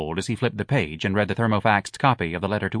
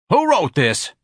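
loud, high outburst; demanding; 10 semitones higher, 6 dB louder, slower than usual. A narrator's reading. Mister Levy confronts the office about the letter, demanding. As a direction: demand it at full voice, pitched way up. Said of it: "Mister Levy demanded".

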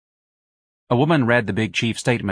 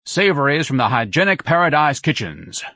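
lightly voiced grand sales pitch, higher; pompous hawker; 5 semitones higher, 4 dB louder than usual. A narrator's reading. Ignatius hawks his wagon to the ladies, pompous. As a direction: announce the wares with mild pomp.

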